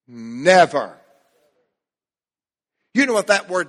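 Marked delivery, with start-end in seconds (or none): 0.1-1.0 "Never" hit hard and louder; emphatic never. Shown as a demonstration. Preacher stressing the word never to the church, emphatic. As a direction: land "Never" like a verdict. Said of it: "with emphasis".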